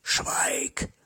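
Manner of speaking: a hissed whisper in the ear. A narrator's reading. Neighbor whispering into the narrator's ear, a hushed whisper. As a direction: breathe it right into his ear. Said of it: "flüsterte er mir ins Ohr".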